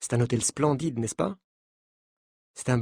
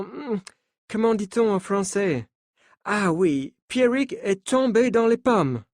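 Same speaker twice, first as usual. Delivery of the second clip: put-on higher French with a light American accent; 7 semitones higher than usual. Coach telling the journalists not to panic, in a light American accent. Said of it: "dit-il avec un accent américain".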